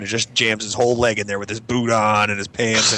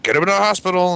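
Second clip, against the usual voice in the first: high-pitched mock urgent order; retold alarmed parents; 9 semitones higher than usual. Parents hearing of the lump, mock alarmed. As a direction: imitate worried parents, voice jumping up.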